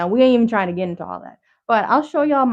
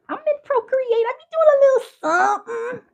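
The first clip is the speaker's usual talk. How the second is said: squeaky playful voice; 3 dB louder than usual. Pretend. Playful voice about using Procreate.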